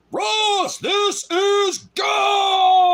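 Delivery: very high, very loud, drawn-out mock divine call; mocking God's booming call from the sky. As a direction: ham up a thundering heavenly announcement. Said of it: "Brother Ross doesn't hear".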